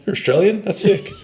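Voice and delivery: high excited mock British fan. UK local meeting an Australian, high excited mock gush. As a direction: gush about the accent like a thrilled local. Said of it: "they're like".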